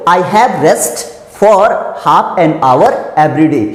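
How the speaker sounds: very slow, word by word dictation. Shown as a demonstration. Teacher reading the example sentence slowly to students. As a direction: spell out each word slowly for the class. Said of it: "example लिखा है".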